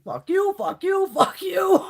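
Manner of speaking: hammed-up movie-style shout, high and loud; playful shouted fuck you. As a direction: belt it out like the movie scene for fun. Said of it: "I'm like in the Half Baked movie".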